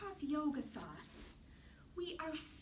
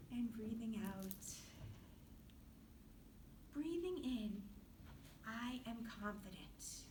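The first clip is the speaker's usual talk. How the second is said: guided relaxation; 5 semitones lower, 8 dB quieter than usual. Acted. Teacher guiding children through yoga rest, in a soft hush. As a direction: lull them into rest, softly.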